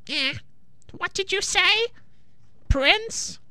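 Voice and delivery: put-on very high, croaky deaf old witch; croaking witch. A narrator's reading. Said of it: "croaked the witch".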